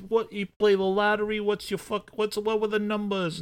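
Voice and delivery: high-pitched, chatty put-on voice; John McClane. Host as the hero stalling about the lottery, mock small talk. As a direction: fumble through nosy small talk up high.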